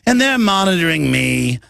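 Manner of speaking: lightly put-on secret agent voice; a hint of a mysterious spy; mimicking a famous spy. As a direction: imitate the spy's odd way of talking, half-joking. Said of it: "And he talks like that".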